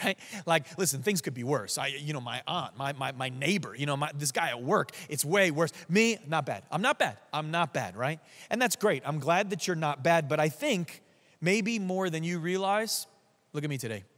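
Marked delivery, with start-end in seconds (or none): none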